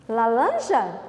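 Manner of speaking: Lalanja with L for the R; Cebolinha's speech. Shown as a demonstration. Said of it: "a fala de Ceboli-, do Cebolinha".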